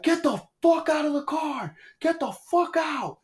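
strained high yell, drawn out at the end; retold yelling. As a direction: act out yelling at an intruder. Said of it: "and I'm yelling".

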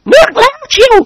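high lady voice opening on a giggle; giggling. A narrator's reading. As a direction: giggle then tease. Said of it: "หัวเราะคิก". (หัวเราะคิกแล้วพูดแซว)

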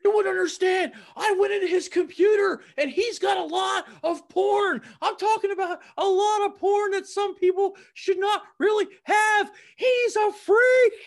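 very high, loud hammed-up yelling; shouting comedy sketch voice. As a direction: scream it like a manic sketch character. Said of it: "the Saturday Night Live thing".

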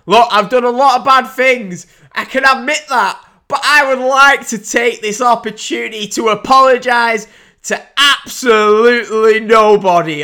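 loud, high mock Irish brag; Conor McGregor. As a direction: put on a cocky Irish accent, drawing out the punchline. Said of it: "He goes all Conor, Conor McGregor".

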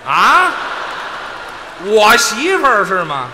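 loud, high, drawn-out indignation; outraged disbelief. Acted. Straight man learning it is his wife, loud outraged protest. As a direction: blurt it out, loudly affronted.

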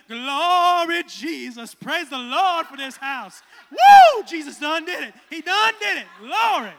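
loud joyful praise with a high woo; praising mom. Retold or imitated. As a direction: shout praise like a church mother.

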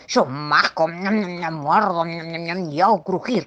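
relishing chomps between the words; munching monster. Acted. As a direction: chew on the words like a little monster. Monster answers with chomping noises.

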